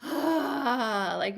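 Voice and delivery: long audible release sigh; big demonstrated sigh. Shown as a demonstration. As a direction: let the breath out with a sigh. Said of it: "you're gonna audibly sigh".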